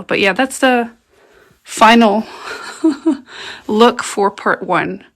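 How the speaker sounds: ordinary talk, nothing marked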